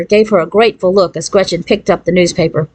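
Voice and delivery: usual speaking voice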